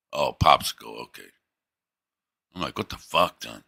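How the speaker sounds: ordinary talk, nothing marked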